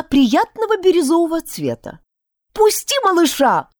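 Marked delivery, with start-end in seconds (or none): 2.5-3.7 sharp high cry to let go; shouting. Acted. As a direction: shout it out in alarm. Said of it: "закричала я". (крик)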